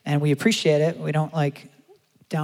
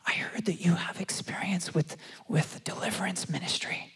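whisper; 3 semitones higher, 8 dB quieter than usual. Retold or imitated. Event coordinator quietly confides to the preacher, whispering. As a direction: lean in and whisper it like a secret.

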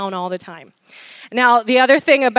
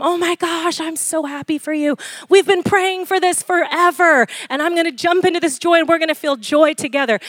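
higher, bright excited gush; genuine joy; 5 semitones higher than usual. Retold or imitated. Preacher voicing a friend's real delight at answered prayer. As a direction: jump into their joy with them.

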